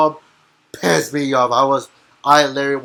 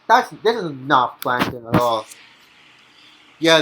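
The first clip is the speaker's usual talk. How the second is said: ordinary delivery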